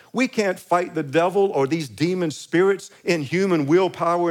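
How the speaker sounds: building preacher's fervor; fervent preaching. Acted. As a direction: preach it to the room. Preaching with rising force.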